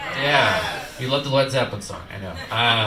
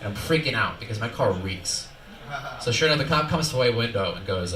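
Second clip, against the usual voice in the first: ordinary talk, nothing marked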